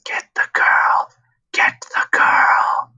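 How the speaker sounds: slow eerie whisper. Acted. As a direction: breathe it slowly, repeating. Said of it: "whispering repeatedly in his head".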